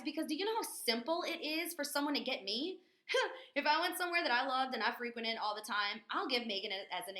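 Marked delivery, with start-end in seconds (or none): none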